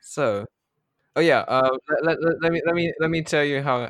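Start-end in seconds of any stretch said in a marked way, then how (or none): 1.1-3.9 loud, higher, eager stammer; excited. Real, not acted. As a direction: rush to grab the table. GM excitedly grabs the floor.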